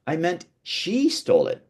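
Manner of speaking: "she" pitched high and stretched; stress on SHE. Shown as a demonstration. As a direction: correct them by punching "she". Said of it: "changes the meaning".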